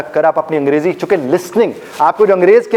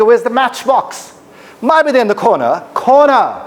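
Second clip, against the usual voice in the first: higher British accent, "corner" with no r; English accent demo; 6 semitones higher, much slower than usual. Shown as a demonstration. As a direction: show off a British accent, "corner" ending in an open vowel. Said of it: "ये आपके वाला कॉर्नर है". (अंग्रेज़ी लहजे में corner, र की आवाज़ के बिना)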